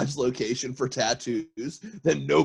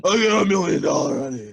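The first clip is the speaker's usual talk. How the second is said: throat-cleared retake; 7 semitones higher, 6 dB louder than usual. Pretend. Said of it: "clear your throat first".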